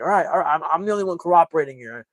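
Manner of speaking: high, loud protesting cop voice; exasperated cop. Retold or imitated. Colorado cop protests to the others, high exasperated voice. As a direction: insist you're the reasonable one. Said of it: "The guy from Colorado is like".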